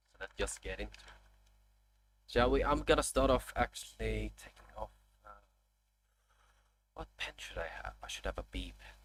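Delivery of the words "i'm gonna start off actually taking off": usual speaking voice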